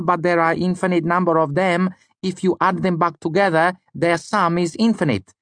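put-on high voice in an odd Lanati accent. A narrator's reading. Student reasoning about infinity, odd Lanati accent. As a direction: explain it in a high, foreign-sounding Lanati accent. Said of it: "in his odd Lanati accent".

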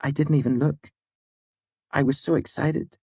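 high-pitched, regretful confession; mournful. A narrator's reading. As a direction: confess the mistake in dismay. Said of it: "she said mournfully".

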